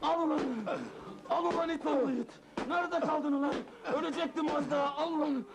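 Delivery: high-pitched, raging tirade; furious scolding. Acted. Boss hitting late Mazlum, furious. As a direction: rant wildly between blows.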